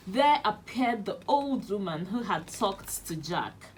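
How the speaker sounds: plain reading voice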